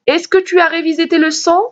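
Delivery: fast, rising at the end of Est-ce que tu as révisé tes leçons?; teacher showing a question tune; question intonation. Shown as a demonstration. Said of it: "quand je veux poser une question, je dis".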